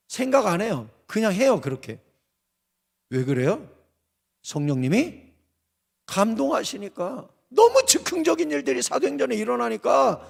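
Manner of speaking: normal talking voice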